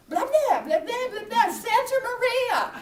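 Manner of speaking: high, loud mock gibberish; hammed-up frantic shouting in nonsense syllables; imitated incomprehensible yelling. What non-English speakers heard from her, mock frantic yelling. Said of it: "all they were hearing was".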